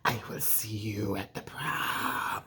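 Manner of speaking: low, slow, breathy menacing whisper; hushed sinister threat, dragged out; creepy killer whisper. Retold or imitated. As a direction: whisper it into the phone like a stalker. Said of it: "they're like".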